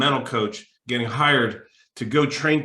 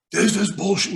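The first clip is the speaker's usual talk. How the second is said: higher, mild mock yell at a ticket agent; mock angry yell; 8 semitones higher, 3 dB louder than usual. Passenger on the phone berating an agent, mild mock yell. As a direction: imitate a furious caller, pitched up. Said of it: "He's screaming".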